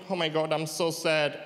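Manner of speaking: lightly imitated sad Indian accent; a touch of sorrow in a mock Indian accent. Comedian imitating his Indian friend when sad, mock Indian accent. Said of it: "wenn sie traurig sind".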